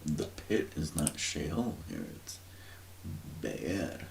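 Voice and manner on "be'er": usual speaking voice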